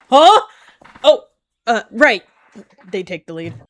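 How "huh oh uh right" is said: very loud, high startled huh. Acted. Dozing cat startled awake, loud and high. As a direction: jolt awake in surprise.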